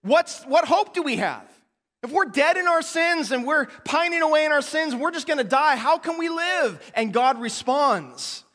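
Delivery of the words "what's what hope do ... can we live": high, loud anguished plea; despairing Israelites. Retold or imitated. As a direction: cry out as if all hope is gone. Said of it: "their response is".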